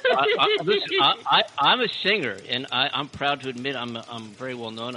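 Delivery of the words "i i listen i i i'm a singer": squeaky, laughing comedian bit; high-pitched comic voice. Acted. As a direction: push it up high and silly. Comic boast routine.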